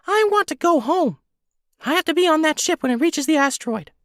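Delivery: very high, squeaky little creature voice; squeaky helium voice. Acted. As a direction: talk like you sucked a helium balloon. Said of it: "in his squeaky helium balloon voice".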